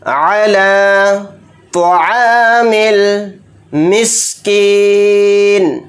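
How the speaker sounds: slow, word-by-word chant; Quran recitation. Acted. Teacher reciting word by word for students. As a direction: recite each word separately and slowly.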